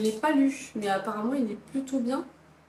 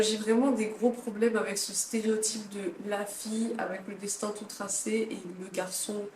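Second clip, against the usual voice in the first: ordinary delivery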